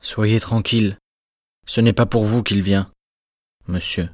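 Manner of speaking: soft calm voice. A narrator's reading. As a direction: soothe a dying woman. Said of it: "de sa voix la plus douce et la plus calme".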